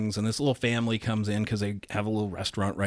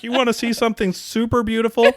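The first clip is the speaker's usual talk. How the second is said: put-on high, laughing six-year-old's line, louder; laughing kid quote; 12 semitones higher, 5 dB louder than usual. Retelling the boy showing Nan her photo, laughing. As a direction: giggle through the kid's pickup line. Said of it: "was like".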